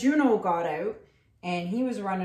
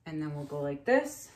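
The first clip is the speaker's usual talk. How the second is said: usual speaking voice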